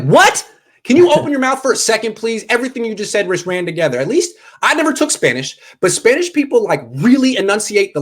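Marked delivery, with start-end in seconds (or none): 0.0-4.0 high, incredulous asking; high-pitched mock bewilderment; baffled listener. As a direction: play lost and pleading for clearer words. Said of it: "French people just talk like this".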